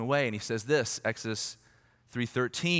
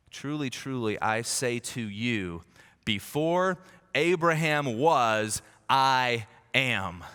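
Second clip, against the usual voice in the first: slow solemn quote; much slower than usual. Retold or imitated.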